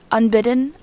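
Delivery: usual speaking voice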